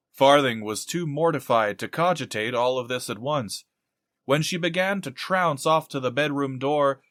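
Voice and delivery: ordinary delivery, nothing marked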